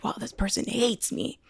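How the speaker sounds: softly hurt, mock-wounded; a quiet mock whimper of offense; lightly acted hurt feelings; hurt little reaction. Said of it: "this person's like".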